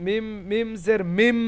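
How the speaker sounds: slow spelling, last "মিম" held on m; merged m. Shown as a demonstration. Teacher spelling the merged form, held m. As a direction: let the final "মিম" hum on a long m. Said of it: "সরাসরি মিমের সঙ্গে মিলছে".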